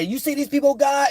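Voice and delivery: high mocking preacher whine; mock pious voice. Imitating critics asking God about him, high mock whine. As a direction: ape a self-righteous believer, high pitched. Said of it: "take this and say".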